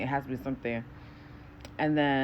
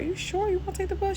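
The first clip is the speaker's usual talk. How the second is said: high voice; faster than usual. Retold or imitated. Her own caring question.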